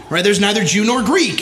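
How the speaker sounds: ordinary talk, nothing marked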